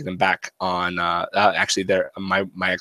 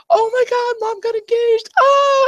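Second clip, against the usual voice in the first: put-on much higher, squealing excited girl; daughter gushing; 5 dB louder than usual. Daughter calling dad, squealing excited news. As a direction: gush like a thrilled kid. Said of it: "She's like".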